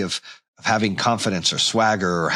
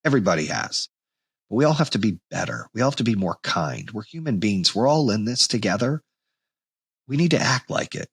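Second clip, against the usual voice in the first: ordinary delivery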